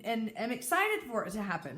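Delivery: usual speaking voice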